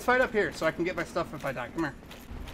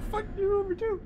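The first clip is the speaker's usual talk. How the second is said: words broken by laughter; laughing; 12 semitones higher than usual. Real, not acted. Cursing the killer while laughing hard. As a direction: say it while cracking up.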